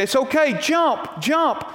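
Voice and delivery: high, urgent call to jump; father urging. Retold or imitated. Father calling up to his frightened son on the roof, high urging. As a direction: coax the boy to leap, calling up. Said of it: "And the dad say".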